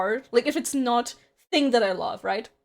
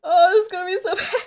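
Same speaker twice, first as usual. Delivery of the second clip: laughing; 7 semitones higher, 6 dB louder than usual. Real, not acted. Laughing at own joke.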